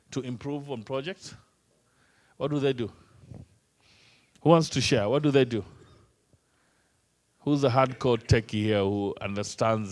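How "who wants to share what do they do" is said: ordinary delivery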